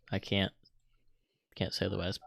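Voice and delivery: ordinary talk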